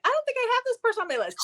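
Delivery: lightly voiced carefree self; quick mock quote. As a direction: toss it off high and quick. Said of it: "I was like".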